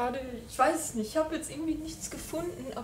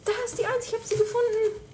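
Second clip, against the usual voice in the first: high, louder joyful find; excited; 10 semitones higher, 4 dB louder, faster than usual. Real, not acted. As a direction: squeal with delight. Finds door number one.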